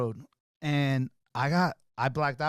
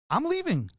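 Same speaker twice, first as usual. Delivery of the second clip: high-pitched, worked-up protest; imitated agitated back-and-forth; retold heated refusal; 12 semitones higher than usual. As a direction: re-enact storming out in a huff.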